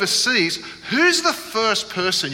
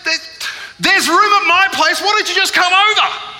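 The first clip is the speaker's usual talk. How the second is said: high, rushed, stumbling offer; panicked; 14 semitones higher, 5 dB louder than usual. Retold or imitated. Boy panicking on stage, high and blurting. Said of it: "he panics even more".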